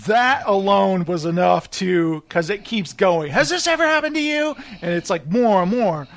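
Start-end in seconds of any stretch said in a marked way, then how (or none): 3.3-4.6 higher, fast TV-ad pitch; hammed-up late-night lawyer ad; mock pitchman asking the viewer; lawyer commercial voice. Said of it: "you know those lawyer commercials".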